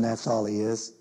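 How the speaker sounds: ordinary delivery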